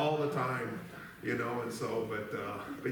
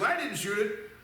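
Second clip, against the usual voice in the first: Greg denying; 9 semitones higher than usual. Retold or imitated. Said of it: "And Greg goes".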